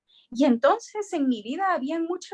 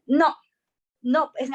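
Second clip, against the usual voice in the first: indignant no; 3 semitones higher, 5 dB louder, slower than usual. Retold or imitated. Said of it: "Y yo decía".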